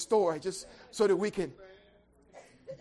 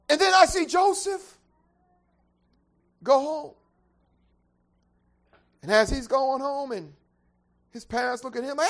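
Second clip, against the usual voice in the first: loud, high whooping sermon climb; preaching at full force; 5 semitones higher, 12 dB louder than usual. Real, not acted. Preacher whooping to the congregation, loud and high. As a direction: build the sermon to a roaring peak.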